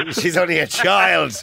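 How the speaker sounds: high, drawn-out protest; mock horror. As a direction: stretch it out in hammed-up parental shock. Acts out his own shocked reaction.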